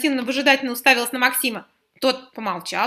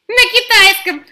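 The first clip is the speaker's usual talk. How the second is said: mocking snort, high and loud; snorting retort; 9 semitones higher, 10 dB louder, slower than usual. Boy mocks the new teacher, snorting loudly. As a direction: fire back with a cheeky snort. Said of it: "фыркнул Стас".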